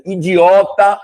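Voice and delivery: loud, high "idiota"; punched-out word. Acted. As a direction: fire the word out. Reading list, word stands out.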